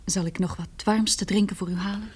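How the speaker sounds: soft whisper; whispered offer. Acted. Woman whispers an offer of a warm drink to the resting professor. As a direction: whisper so as not to disturb him. Quiet sickroom.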